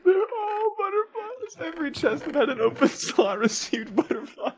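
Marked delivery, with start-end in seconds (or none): 0.0-3.4 crying with laughter. Real, not acted. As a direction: squeak it out while laughing. Prankster cracking up.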